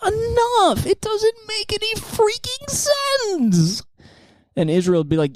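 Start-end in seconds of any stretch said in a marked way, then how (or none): 0.1-3.8 high, slow, exasperated whine; high drawn-out exasperated scolding; relatable Khomeini. Acted. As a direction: plead with Israel like a fed-up friend. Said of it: "more relatable if he's like".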